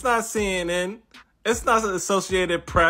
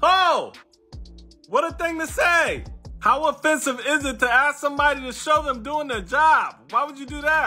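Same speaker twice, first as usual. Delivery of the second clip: sarcastic mock outrage; 7 semitones higher than usual. Sarcastic mock outrage, high pitch.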